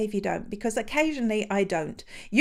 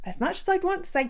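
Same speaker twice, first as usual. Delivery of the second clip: ordinary talk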